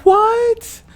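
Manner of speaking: high-pitched, incredulous "what"; shocked disbelief. Real, not acted. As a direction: react in real shock, voice shooting up. Shocked at not being fed.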